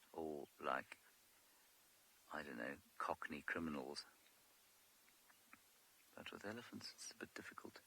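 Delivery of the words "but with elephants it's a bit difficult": hushed whisper, softer. Real, not acted. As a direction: whisper so the herd doesn't hear. Waiting behind bush near elephants.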